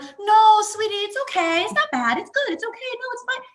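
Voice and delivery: high, loud, brushing-off parent voice; dismissive cheery parent. Retold or imitated. Mocking a parent who brushes off a child's pain, high and cheery. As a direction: wave the child's hurt away in a sing-song. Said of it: "It's not that".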